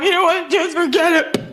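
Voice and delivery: high-pitched tearful argument voice; mock tearful outburst. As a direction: build into a weepy, high give-up. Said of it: "Your argument will magically be transformed from".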